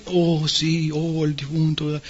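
slow, subdued funeral sympathy; mournful condolence. Retold or imitated. Funeral usher consoles about el difunto, mournful. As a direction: imitate a gloomy, consoling undertaker. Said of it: "una manera un poco tenebrosa y triste".

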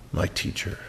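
breathy hushed whisper of awe. Acted. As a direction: say it under the breath, in wonder. Repeats Mary's words, hushed.

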